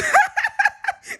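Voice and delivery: loud high laugh; high burst of laughter. Real, not acted. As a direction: laugh at your own joke. Laughing at her own punchline.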